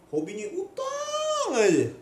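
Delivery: high loud excited outcry; emphatic exclaim. Real, not acted. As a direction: exclaim it with disbelief. Emphatic complaint about debtors.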